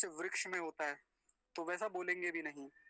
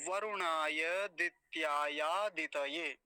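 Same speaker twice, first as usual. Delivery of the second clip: the whole phrase slowly, slightly louder; slow combined recitation; 3 dB louder, much slower than usual. Shown as a demonstration. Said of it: "सभी को मिला के कैसे बोला जाएगा". (मिलाकर धीरे पाठ)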